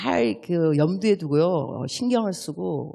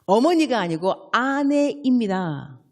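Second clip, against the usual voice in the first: ordinary talk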